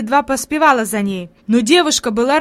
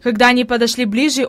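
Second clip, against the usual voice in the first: plain reading voice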